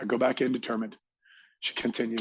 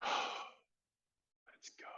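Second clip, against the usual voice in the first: sigh, then a breathless whisper; whispered let's go; 14 dB quieter than usual. Acted. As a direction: whisper it, itching to take off. Eager on the runway, waiting.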